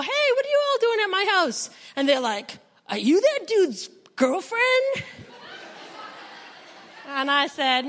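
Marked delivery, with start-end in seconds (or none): 0.0-1.7 put-on high, fast startled call to the kids; surprised call out. Arriving home to strange kids. 2.9-5.0 put-on high, slow, laughing kid's question; curious kid voice. Kids question the new mom.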